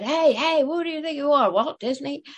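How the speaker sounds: hammed-up indignant guard catching gatecrashers; brash park employee. Young uniformed employee confronting the side-gate intruders, mock shout. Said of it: "he came tearing up and said".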